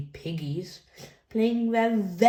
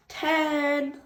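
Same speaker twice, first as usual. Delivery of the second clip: excited; 9 semitones higher than usual. Acted. End of counting.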